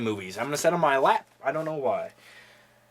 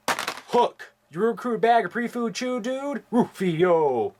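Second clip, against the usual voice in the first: put-on higher kid voice with a hissed Hook; Hook movie quotes; 5 semitones higher, slower than usual. Vlogger quoting Hook, lightly voicing the kids. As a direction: bark the lines like the Lost Boys.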